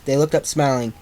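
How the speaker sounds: plain reading voice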